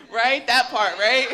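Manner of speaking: laughing. Real, not acted. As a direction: share the joke, still laughing. Laughing with audience.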